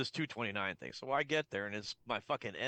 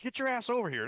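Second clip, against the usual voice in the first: mock bark; 10 semitones higher, 4 dB louder than usual. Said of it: "He's like".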